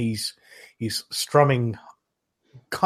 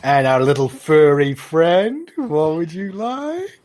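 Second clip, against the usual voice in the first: high, loud doting barmaid; barmaid to pet; 6 semitones higher, 5 dB louder than usual. Acted. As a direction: coo at the little furry guest. Innkeeper asks small creature.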